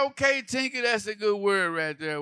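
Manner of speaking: high, loud fake praise; mock enthusiasm. Comedian overpraising Tinker's word, high and loud.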